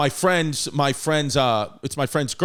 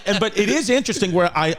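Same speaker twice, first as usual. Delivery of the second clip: normal talking voice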